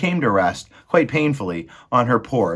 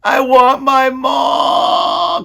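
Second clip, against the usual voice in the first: high, loud, slow wail; crying out for ma; 12 dB louder, much slower than usual. Acted. Meg crying for her mother, high, loud and slow. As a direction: wail it into the silence. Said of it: "cried Meg".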